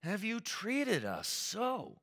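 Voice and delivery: lightly voiced upset mother, rising high; a hint of a worried mother scolding; frustrated mother. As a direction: plead with a hurt, exasperated edge. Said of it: "she's even more frustrated".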